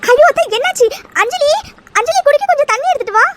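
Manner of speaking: high, loud cry of alarm. Acted. Daughter-in-law calling for water for ailing aunt, alarmed. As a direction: call urgently for help. Mother-in-law suddenly unwell.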